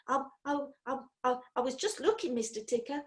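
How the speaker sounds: frightened stammer. Acted. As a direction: stutter it out, scared stiff. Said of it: "could scarcely get out the words".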